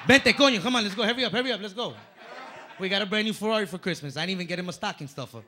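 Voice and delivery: wife shouting to hurry to the new car; excited urging. Retold or imitated. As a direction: rush her along, thrilled. Voicing wife urging him, Ferrari.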